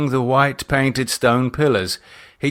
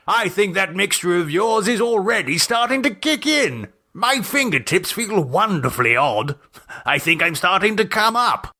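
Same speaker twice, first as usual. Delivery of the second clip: much higher, giddy gent; giddy Gerald; 9 semitones higher than usual. Acted. Gerald tells Beltran the mixture is kicking in, giddy. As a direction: marvel at the high.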